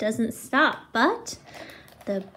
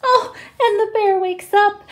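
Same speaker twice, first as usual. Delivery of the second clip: higher, louder cry of surprise; dramatic reveal; 8 semitones higher, 6 dB louder than usual. A narrator's reading. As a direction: make the wake-up a big moment. Dramatic wake-up moment.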